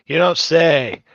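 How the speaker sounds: loud sarcastic outburst; loud sarcasm. Real, not acted. Repeated sarcastic reaction, now loud.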